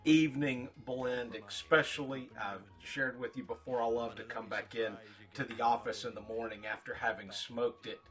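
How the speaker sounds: ordinary talk, nothing marked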